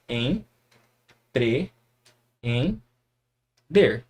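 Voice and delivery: em, pre, em, der said as separate syllables. Shown as a demonstration. Teacher to students, splitting empreender into syllables. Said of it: "vai ficar assim".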